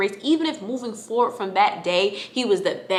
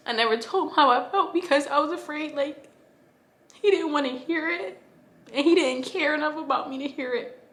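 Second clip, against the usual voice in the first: higher, voice straining with hurt; choking back the hurt as she says it; real hurt pushing the voice up; tearful; 7 semitones higher than usual. Real, not acted. Emotional account of father.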